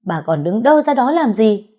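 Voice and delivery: high, loud furious yell; angry shout. Acted. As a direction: snap at the stunned mother-in-law. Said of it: "Trúc tức giận quát lớn".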